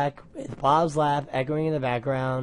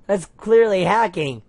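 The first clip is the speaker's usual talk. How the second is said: loud protest; 6 semitones higher, 7 dB louder than usual. Acted. Computer screen protesting.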